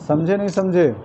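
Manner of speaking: ordinary talk, nothing marked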